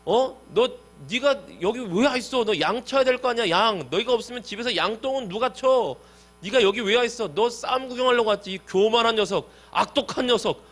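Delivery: high-pitched big-brother telling-off; angry scolding. Retold or imitated. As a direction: act out an older brother berating the youngest. Acts out eldest brother scolding David.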